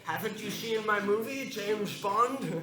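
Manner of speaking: a mock Scottish Bond boast; Sean Connery impression. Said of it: "his impression".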